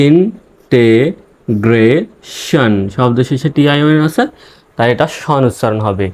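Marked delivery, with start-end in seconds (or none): 0.1-2.7 integration very slowly, syllable by syllable; long gaps between the pieces of integration; broken into syllables. Shown as a demonstration. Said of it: "এভাবে ভেঙে ভেঙে উচ্চারণ করবেন".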